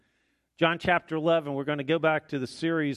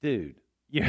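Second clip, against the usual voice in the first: ordinary delivery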